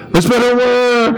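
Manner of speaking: high, called-out rallying cry; mock yell. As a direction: yell it out to the whole army. Guessing the crowd's yell, then louder.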